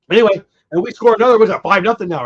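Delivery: fast, loud, pumped-up call; excited. Real, not acted. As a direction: rush it out as the run scores. Team scores another run.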